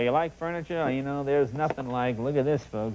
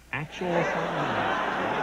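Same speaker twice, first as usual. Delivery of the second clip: slow punchline; much slower than usual. Real, not acted. Punchline delivered slowly.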